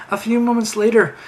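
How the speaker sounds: usual speaking voice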